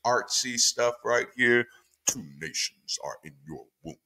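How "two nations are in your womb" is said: hushed, portentous delivery; deep preacher reading. Shown as a demonstration. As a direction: intone it low and weighty, like a preacher. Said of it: "it feels really deep and overwhelming".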